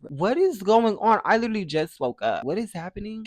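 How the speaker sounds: high, loud confused wake-up; jolted awake and confused; startled. Retold or imitated. Woken by irate call.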